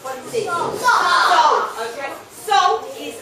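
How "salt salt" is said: loud, high unison answer; the class shouts it out together; chorus answer. Real, not acted. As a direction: pupils calling out the answer.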